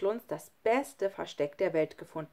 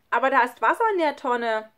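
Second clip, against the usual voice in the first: put-on loud, higher child's protest; worried objection; 5 semitones higher, 8 dB louder than usual. A narrator's reading. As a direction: call the objection up anxiously. Worried child calls up in story. (lauter, besorgter Einwand)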